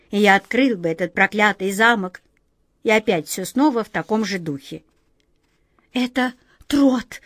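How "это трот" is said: медленно, на вздохе, потрясённо; выдохнуть имя, задыхаясь от волнения. A narrator's reading. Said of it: "задохнулась Ниточка". (задыхаясь от волнения)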